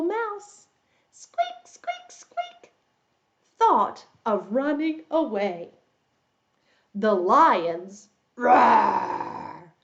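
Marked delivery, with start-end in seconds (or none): 1.1-2.7 tiny, very high squeaks; mouse squeaks. Pretend. Storyteller plays the little mouse for young listeners, high squeaking. 8.4-9.7 drawn-out play lion roar. Pretend. Storyteller plays the lion for young listeners, drawn-out roar.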